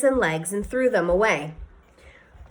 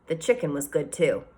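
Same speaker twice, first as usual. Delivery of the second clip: ordinary delivery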